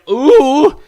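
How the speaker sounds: high, loud "ooh"; loud rising "oooh" of reaction; imitated "ooh". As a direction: hoot a drawn-out, impressed "ooh". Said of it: "I was going".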